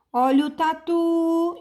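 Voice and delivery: slow, high cry, TU held long; street vendor's call. Shown as a demonstration. Teacher testing tatu with the selling trick, vendor's call. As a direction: sell tatu like a hawker. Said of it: "é você vender a palavra".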